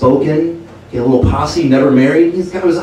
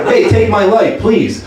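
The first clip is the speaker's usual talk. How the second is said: higher, upbeat club-comic patter; stand-up comic Jesus; 7 semitones higher, slower than usual. Retold or imitated. Jesus on the cross opening his comedy set. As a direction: work the room like a cheesy headliner. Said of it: "ultimate stand-up comedian".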